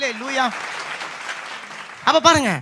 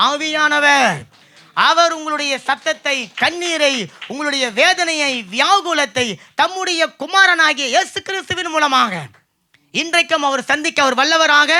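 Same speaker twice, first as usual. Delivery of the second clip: higher, driving sermon delivery; impassioned preaching; 4 semitones higher than usual. Real, not acted. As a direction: preach it out with rising fervour. Preaches at a peak. (உணர்ச்சி பொங்கும் பிரசங்கம்)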